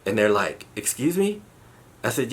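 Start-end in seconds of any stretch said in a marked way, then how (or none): 0.8-1.4 a baffled phone voice, pitched way up; high, questioning excuse me; puzzled excuse me. Retold or imitated. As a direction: excuse me, jumping high with a rising end.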